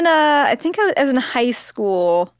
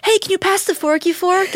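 put-on higher, naive little kid voice; 8 semitones higher than usual. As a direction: play a confused kindergartner. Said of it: "they're like".

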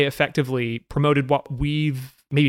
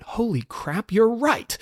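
surprised realization; 8 semitones higher, slower than usual. Retold or imitated. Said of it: "who went".

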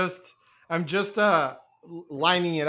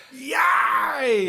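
mock cheer; 5 semitones higher than usual. Imitates a celebratory cheer.

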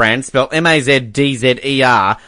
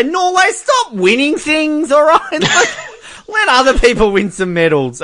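mock plea; 12 semitones higher than usual. Pleading with Norway jokingly.

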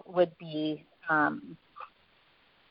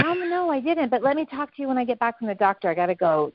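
hurried mom; 6 semitones higher, 3 dB quieter than usual. Retold or imitated. Mom rushing off to doctor.

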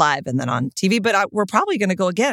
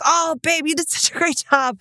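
tender comfort; 9 semitones higher than usual. Retold or imitated. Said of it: "and I'm like".

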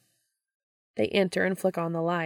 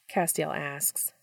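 ordinary delivery

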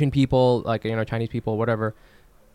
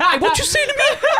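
much higher, louder mock challenge in a thick Korean accent, laughing; hammed-up angry Korean mom; 4 dB louder than usual. Host voicing a Korean mom confronting someone, in a loud exaggerated Korean accent. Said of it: "That's the Korean mom".

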